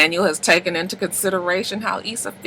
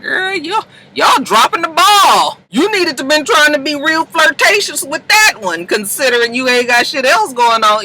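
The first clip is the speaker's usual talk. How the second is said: sassy scolding; 9 semitones higher than usual. Real, not acted. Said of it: "I was like".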